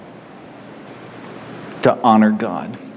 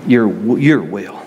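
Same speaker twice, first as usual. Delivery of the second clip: closing prayer. Acted.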